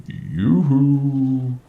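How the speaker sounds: high, drawn-out sing-song call; loud, lilting yoo-hoo held long on the last note; eerie calling presence. Retold or imitated. Unseen presence at a terrified listener's ear, eerie high call. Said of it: "right next to my ear says".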